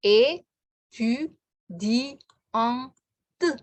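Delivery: é, tu, di, ang, te spoken apart one by one; syllable drill for the class; split into syllables. Shown as a demonstration. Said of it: "Ada lima suku kata".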